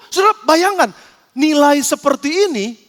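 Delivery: ordinary talk, nothing marked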